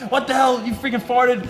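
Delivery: high-pitched retold mom's yell; mock yelling. Mom yelling at dad over the stink, mock yell. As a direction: imitate an outraged mom yelling, half laughing. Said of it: "you got the mom yelling at dad".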